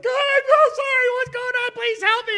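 high, loud hammed-up wailing sinner; mock desperate plea. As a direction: shout it like a sinner begging God, overdone.

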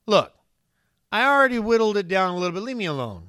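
a touch of an annoyed dad; irritated parent. Retold or imitated. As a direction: brush off a nagging kid, half-joking. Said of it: "I'd be pretty irritated".

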